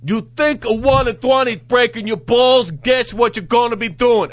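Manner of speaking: thick New York cop accent, high and loud. Shown as a demonstration. Host rereading the quota quote in a New York accent. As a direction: bark it like a New York precinct boss. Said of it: "I should read that in a New York accent".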